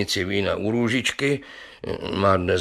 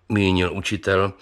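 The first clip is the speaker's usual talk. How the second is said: ordinary delivery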